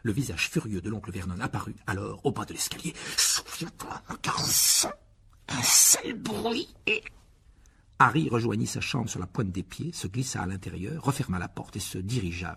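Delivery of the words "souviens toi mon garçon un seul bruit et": hissed warning, trailing slow; whispered threat. A narrator's reading. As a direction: menace him under your breath. Furious uncle threatening Harry.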